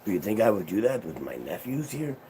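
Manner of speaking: loud, high indignant protest fading toward normal; outraged at being accused, then calming; mock-offended uncle. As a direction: play the wounded innocent. Uncle feigning offended innocence.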